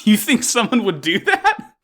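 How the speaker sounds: mock-shocked, laughing; sarcastic meme voice, laughing. Sarcastic internet meme quote.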